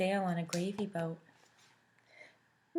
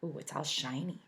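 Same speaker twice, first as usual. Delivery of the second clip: usual speaking voice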